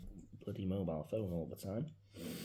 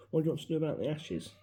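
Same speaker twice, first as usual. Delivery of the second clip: louder, brisk imitation of dad; dad on the phone; 8 semitones higher, 6 dB louder, faster than usual. Son retells dad's phone call about ashes: brisk louder question. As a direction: rattle it off like a practical dad.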